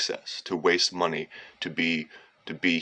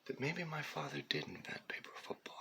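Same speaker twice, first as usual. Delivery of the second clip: breathy near-whisper, much softer; whispered aside; 8 semitones higher, 17 dB quieter than usual. Real, not acted. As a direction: whisper it like a secret guess. Musing aside about his father.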